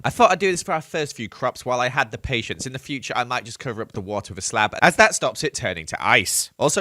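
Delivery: ordinary delivery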